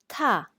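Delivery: "ta" falling sharply, fourth tone. Shown as a demonstration. Teacher models Mandarin fourth tone on ta, falling. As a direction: slam "ta" down like the hey. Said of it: "please listen carefully".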